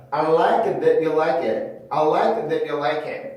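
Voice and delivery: "that you" split, T stopped before "you"; cut-off T. Shown as a demonstration. Teacher showing the second method, T cut off. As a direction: cut "that" short, then a clean "you". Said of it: "끊어서 발음을 해주는 방법이 있어요".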